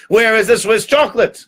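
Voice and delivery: imperious, high-pitched question; demanding duke. Acted. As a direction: demand it indignantly. Duke demands missing chocolate.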